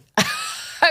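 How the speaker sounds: sudden high burst of laughter; high, helpless laugh; incredulous laugh. Real, not acted. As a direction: crack up in disbelief.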